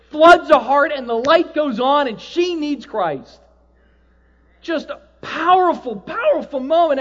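high, loud, driving sermon; impassioned preacher at full power; full-force preaching. Acted. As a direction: preach it with fire.

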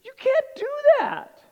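high and loud forbidding; scandalized wife. Retold or imitated. Wife forbids husband's shoyu, high and scandalized. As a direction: bar him from it in shock. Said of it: "She goes".

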